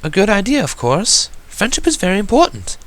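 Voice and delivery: high, elegant lady's voice; refined lady. Acted. Boutique owner approves the plan, high and gracious. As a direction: speak with gracious poise.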